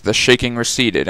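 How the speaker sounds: ordinary delivery, nothing marked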